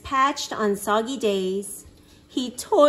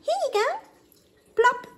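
much higher little critter voice; squeaky hermit crab; faster than usual. Acted. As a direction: offer it kindly in a tiny voice.

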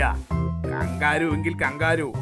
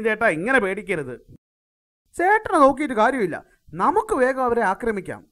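excited; 8 semitones higher, 6 dB louder, faster than usual. Acted. Urging attack, loud excited.

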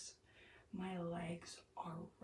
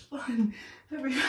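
loud; 4 semitones higher, 11 dB louder than usual. Real, not acted. In an ice bath.

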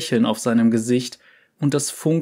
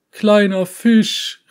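put-on high, loud, slow sing-song call of an old uncle; calling out cheerfully; 9 semitones higher, 6 dB louder, much slower than usual. A narrator's reading. Uncle calling to his niece from the fog, high and loud. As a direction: stretch it out like greeting a child from afar. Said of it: "rief eine Stimme".

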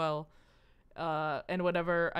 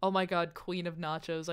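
normal talking voice

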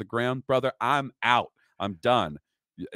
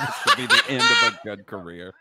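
laughing. Real, not acted. Host repeats clip line laughing.

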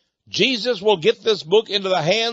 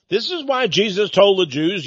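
normal talking voice

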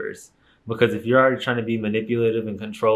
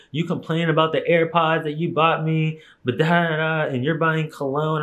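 higher, whiny mock of her boyfriend; 6 semitones higher than usual. Boyfriend griping about her cologne, mocking higher whine. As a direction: mimic him griping, pitched up. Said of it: "'Cause he's like".

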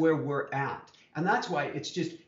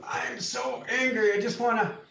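mock angry; 9 semitones higher than usual. Co-host imitating himself walking around: mock angry. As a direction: stretch it out in mock fury. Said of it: "I've walked around going".

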